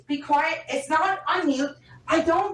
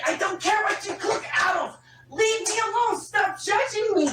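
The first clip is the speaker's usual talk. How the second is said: high-pitched, heated shouting; angry shouting; 5 semitones higher than usual. Real, not acted. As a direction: shout at a partner to back off. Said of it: "please stop shouting".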